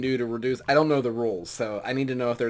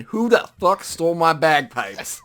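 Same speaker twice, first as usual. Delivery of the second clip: loud, raised-pitch outraged yell; screaming; 5 semitones higher, 9 dB louder than usual. Acted. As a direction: burst out yelling, demanding the culprit.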